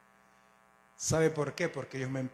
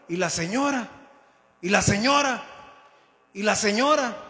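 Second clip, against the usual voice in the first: loud, high repeated call, rising in force; crowd calling out; 5 semitones higher, 7 dB louder than usual. Retold or imitated. Crowd searching for the woman run over, calling out. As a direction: call out urgently for the missing woman. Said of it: "todo el mundo gritando y buscando".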